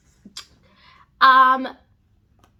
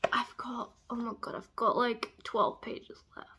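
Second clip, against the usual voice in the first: hushed, anxious whisper; 7 dB quieter than usual. Real, not acted. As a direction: breathe it, dreading the ending. Suspense near the end.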